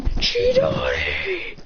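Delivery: "Chidori!" cried out high and dragged very slow; attack cry. Retold or imitated. Ninja leaping at Boruto, crying out the long attack call. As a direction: howl the attack name as he leaps. Jumps up calling attack name.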